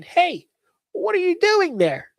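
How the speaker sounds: put-on high, slow little girl voice. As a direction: call out like a small girl. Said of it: "sounds like a little girl".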